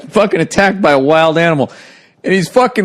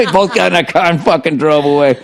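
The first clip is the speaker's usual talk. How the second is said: ordinary delivery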